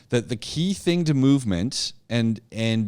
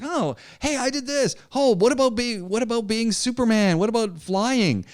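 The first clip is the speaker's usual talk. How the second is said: put-on high-pitched child bursting with ideas; excited kid voice; 11 semitones higher than usual. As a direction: talk like an eager little kid, way up high. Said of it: "talking like the kids".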